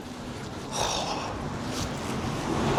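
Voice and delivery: long, soft breathy sigh. Real, not acted. Rejected trainee left to take the bus, long sigh. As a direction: let out a slow, dejected sigh.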